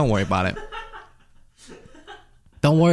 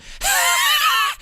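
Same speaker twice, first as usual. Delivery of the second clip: imitated laugh. Imitating JD Vance's genuine laugh.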